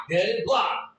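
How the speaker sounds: usual speaking voice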